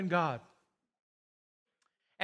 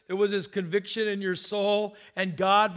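usual speaking voice

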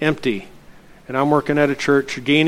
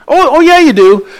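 much higher, louder "oh yeah, you do"; eager insisting; 12 semitones higher, 8 dB louder than usual. Retold or imitated. Gas man retold, insisting eagerly to the pastor. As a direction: insist brightly he's wrong.

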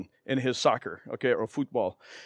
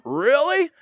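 surprised really; 10 semitones higher than usual. Retold or imitated. Stranger doubting the conversion.

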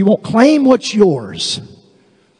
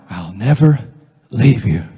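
low, gentle voice falling away; God's reassurance; 9 semitones lower than usual. Acted. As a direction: answer low and steady, with a pause before the last words. God's reply to each confession.

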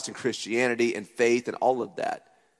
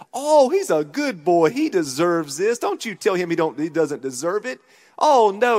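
higher, louder imitation of a doting grandmother; mother-in-law voice; 5 semitones higher, 6 dB louder than usual. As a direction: play the indulgent grandma, high and loud.

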